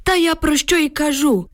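put-on old man's high-pitched, agitated retort; emotional exclamation. A narrator's reading. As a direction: exclaim it with feeling, pitched well up. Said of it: "емоційно вигукнув дід".

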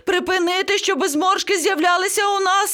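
high-pitched panicked parrot; screeching. A narrator's reading. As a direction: plead in rising panic, very high. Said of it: "зарепетував папуга". (панічний високий крик)

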